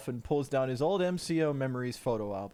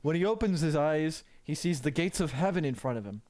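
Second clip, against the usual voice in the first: ordinary delivery, nothing marked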